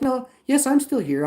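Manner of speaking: loud, very high mock voice; mocking retelling. Streamer retells the support chat exchange, exasperated and high.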